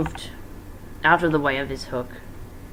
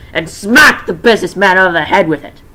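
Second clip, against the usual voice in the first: smacked punched much louder; loud dramatic reread; 4 semitones higher, 12 dB louder than usual. Shown as a demonstration. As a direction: retell the hit with loud force.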